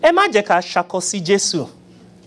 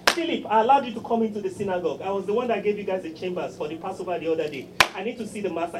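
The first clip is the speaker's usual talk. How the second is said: pushy Jairus; 10 dB quieter, faster than usual. Retold or imitated. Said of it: "calling in his chips".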